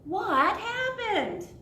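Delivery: high, loud, stretched-out dismay; drawn-out storybook astonishment; shocked question. Acted. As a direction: exclaim it as the boy finding lunch gone. Boy finds lunch gone.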